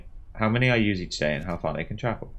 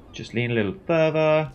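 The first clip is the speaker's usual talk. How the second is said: sinister coaxing; 7 semitones higher, 4 dB louder than usual. Acted. Luring victim over rail.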